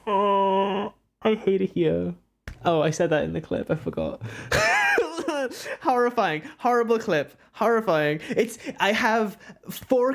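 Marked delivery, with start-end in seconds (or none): 4.3-5.8 cringing high laugh. Real, not acted. Cringing at own clip.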